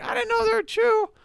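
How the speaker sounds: high, squeaky defensive excuse; defensive squeak. Retold or imitated. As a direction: plead innocence in a high squeak. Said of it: "I was like".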